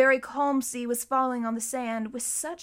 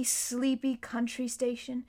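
plain reading voice

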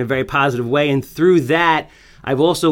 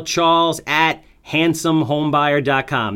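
slow dictation; slower than usual. Shown as a demonstration. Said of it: "That's handsome as in good looking".

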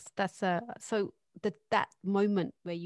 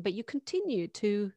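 ordinary delivery

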